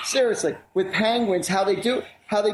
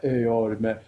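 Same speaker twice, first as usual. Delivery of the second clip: raspy deep voice; 8 semitones lower than usual. Retold or imitated. Neighbor from upstairs greeting Artie in a raspy deep voice. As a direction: drop right down into a cool raspy bass. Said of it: "raspy, deep voice, like".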